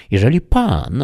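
put-on a touch of a sniffy old housekeeper; haughty housekeeper. A narrator's reading. Housekeeper brushing off Sancho, mildly haughty.

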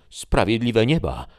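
high, loud exclamation of dismay; shocked interjection. Acted. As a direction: cut in, aghast, calling on heaven. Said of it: "przerwała dama". (wzburzony okrzyk, głośno i wysoko)

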